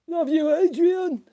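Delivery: high, loud, slow mock call; hammed-up impression shouting his love; terrible impression. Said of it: "What a terrible impression that was".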